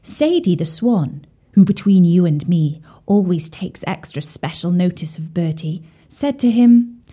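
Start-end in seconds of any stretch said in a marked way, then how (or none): none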